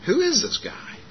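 high-pitched retold puzzlement; lightly played incredulous aside; mock bewilderment. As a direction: act out wondering who this stranger is. [name]'s retold puzzled inner reaction.

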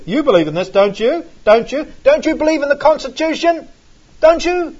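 rising, insistent don't you; mock persuasion. Preacher voicing Paul pushing people to take a stand, rising. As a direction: press the listener for agreement. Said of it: "shows you Paul's technique".

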